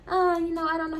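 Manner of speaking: hesitant high squeak; timid, unable to say no; unsure, high voice. Retold or imitated. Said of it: "don't know how to say no ass was like".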